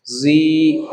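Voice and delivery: ordinary talk, nothing marked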